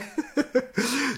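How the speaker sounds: high, light chuckle; self-mocking laugh. Real, not acted. Host mocking his own English accent, self-mocking laugh. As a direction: laugh at your own clumsiness.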